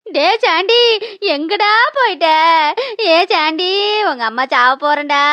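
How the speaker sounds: hammed-up tearful lament, sinking at phrase ends; wailing farewell. Dying mother bids her son goodbye, melodramatic wail. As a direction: mourn yourself to your child.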